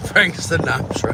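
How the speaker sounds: mock Cam pronouncing [name]; imitating Cam's way. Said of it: "as, uh, Cam might call him".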